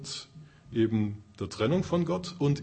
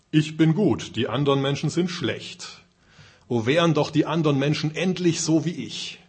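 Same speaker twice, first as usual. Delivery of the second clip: louder and higher, smug and self-satisfied; self-righteous flesh voice; 4 semitones higher, 5 dB louder than usual. Acted. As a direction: proclaim your own goodness to the crowd. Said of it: "die Stimme des Fleisches sagt". (selbstgerecht und laut, die Stimme des Fleisches)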